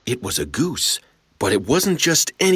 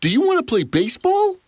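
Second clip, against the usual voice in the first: put-on high, quick eager kid; cheerful invite; faster than usual. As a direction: ask brightly to play.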